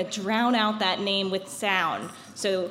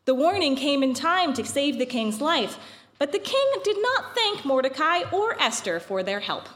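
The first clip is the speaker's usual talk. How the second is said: epic fantasy narrator; 2 semitones higher than usual. A narrator's reading. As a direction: tell it like an old legend. Reads the Purim story aloud.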